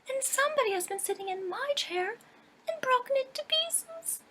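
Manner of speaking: squeaky little bear's high pipe; piping little bear. Acted. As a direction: pipe it up like a tiny cub. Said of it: "the little bear piped".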